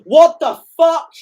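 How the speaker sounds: high, loud, drawn-out disbelief; real shock at what he just saw; shocked outburst. Real, not acted. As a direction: blurt it out, stunned and slow. Spots something on screen, shocked.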